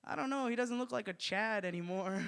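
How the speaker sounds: high, uncertain put-on voice; timid acted doubt; imitated doubtful husband. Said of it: "And he's like".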